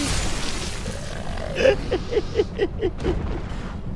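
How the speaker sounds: long, high-pitched giggling fit; gleeful laughter. Real, not acted. Gamer tickled by another fall, gleeful laughter. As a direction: giggle helplessly.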